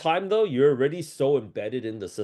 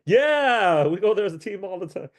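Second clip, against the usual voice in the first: mock eager yes; 6 semitones higher, 4 dB louder than usual. Quoted eager reply.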